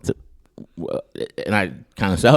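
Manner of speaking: normal talking voice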